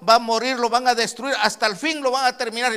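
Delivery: loud, high jeering threat; high mocking yell; mocking shout. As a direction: shout it like the mockers. Preacher voicing enemies' threat.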